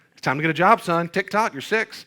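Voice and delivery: loud, high-pitched joking order; hammed-up dad hustling a little kid out to work; mock stern dad. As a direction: playfully bark it, pitched well up. Joking mock orders to young son.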